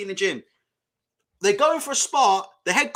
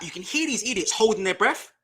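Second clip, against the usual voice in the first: ordinary talk, nothing marked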